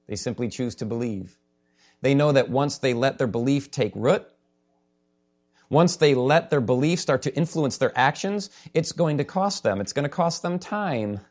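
ordinary talk, nothing marked

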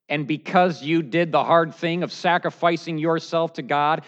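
loud, forceful preaching. Acted. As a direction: drive it home from the pulpit. Full-force sermon challenge.

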